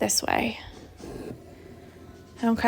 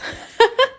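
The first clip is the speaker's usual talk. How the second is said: breathless laugh; 13 semitones higher than usual. Real, not acted. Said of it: "I'm, like, out of breath".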